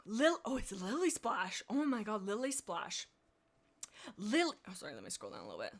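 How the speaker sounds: normal talking voice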